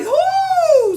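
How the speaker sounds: very high, loud long whoo; awed whoop. Acted. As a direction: whoop at a huge thunderclap. Crowd reacts to the thunder.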